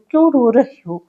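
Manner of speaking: ordinary talk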